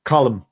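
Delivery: Colm said in two syllables, KOL-um; correct pronunciation. Shown as a demonstration. Telling the co-host how the Irish actor's name is said, two-syllable Colm. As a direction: say Colm as "Collum". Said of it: "his first name is C O L M".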